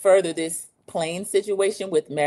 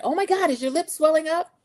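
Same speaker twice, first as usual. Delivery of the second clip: high, alarmed question; shocked; 11 semitones higher than usual. Retold or imitated. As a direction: gasp at the swollen lip. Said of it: "Lisa said".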